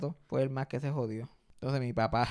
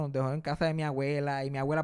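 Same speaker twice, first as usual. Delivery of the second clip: ordinary talk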